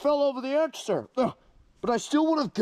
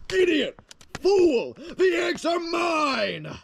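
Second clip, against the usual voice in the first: furious shout; 7 semitones higher, much slower than usual. Acted. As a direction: bellow at the thief as an outraged king. Villain berates egg thief.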